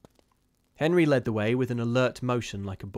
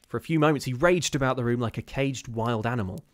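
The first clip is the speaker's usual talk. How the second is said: usual speaking voice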